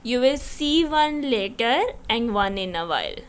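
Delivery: ordinary talk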